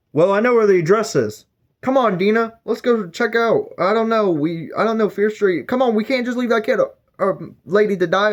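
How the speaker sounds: high, urgent pleading; rally the others, pitched high; eager hero. Retold or imitated. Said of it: "And he's like".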